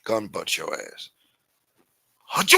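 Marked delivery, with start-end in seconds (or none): 2.2-2.6 very high, loud barked mock Chinese accent; hammed-up Chinese-accent yell; mock Chinese soldier. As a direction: shout orders in a crude Chinese-accent impression. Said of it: "how they talk".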